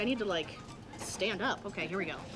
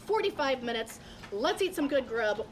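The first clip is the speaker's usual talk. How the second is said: normal talking voice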